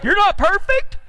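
high-pitched incredulous wife; wife's mock disbelief. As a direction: ask it high, rising in mock surprise. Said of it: "My wife just said".

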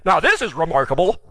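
understated sarcasm at normal pitch; sarcastic. Acted. Healed man needling religious people, understated sarcasm. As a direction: say it with dry mock wonder. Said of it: "now he's being sarcastic".

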